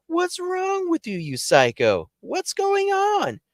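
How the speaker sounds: high-pitched mock yelling; stretched, high squeal of disbelief; alarmed exasperation. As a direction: demand answers in a hammed-up panic. Yelling back at screaming friend.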